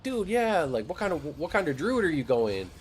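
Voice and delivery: high, enthusiastic welcome; acted keen helpfulness; friendly eager player. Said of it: "we're just like".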